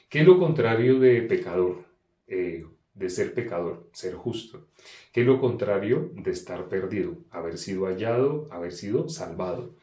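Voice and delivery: ordinary talk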